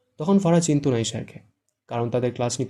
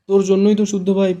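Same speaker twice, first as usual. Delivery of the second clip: higher, louder accusation; angry blame; 7 semitones higher, 9 dB louder than usual. A narrator's reading. Fara blames Shahed, higher and louder. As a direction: snap the blame at him.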